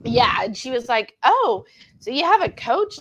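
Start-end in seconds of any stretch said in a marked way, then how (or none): 1.2-3.0 higher, rising voice for the coworker; mock curious surprise. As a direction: act out the coworker's curious question.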